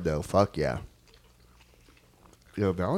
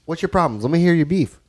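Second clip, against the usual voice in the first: mock challenge; 8 semitones higher, 5 dB louder than usual. Real, not acted. Challenging friend to criticize pizza.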